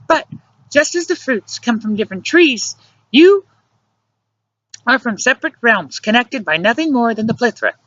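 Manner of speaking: high-pitched female character voice; earnest reasoning woman. A narrator's reading. Luciana tells him he is not Ben, earnest reasoning.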